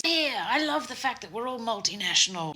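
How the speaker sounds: high, put-on boss voice; booming boss. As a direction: mimic a self-important boss addressing newcomers. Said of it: "booming voice".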